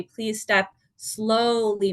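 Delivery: very slow, each word drawn out; slow careful warning. Shown as a demonstration. Caregiver warning her mom about a steep step, slowly. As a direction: ease the words out slowly. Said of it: "you may want to say".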